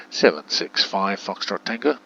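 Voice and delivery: loud, clear controller call; projected air traffic control callsign; tower radio call. Pretend. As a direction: call the callsign out firmly, well above chat level. Calling out aircraft to game.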